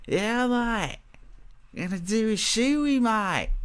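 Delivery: put-on jokey Australian accent; mock Australian accent. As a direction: play a rowdy Aussie fan in a mock accent. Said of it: "the Aussie".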